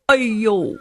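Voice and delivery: ordinary delivery, nothing marked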